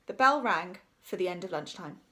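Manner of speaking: ordinary delivery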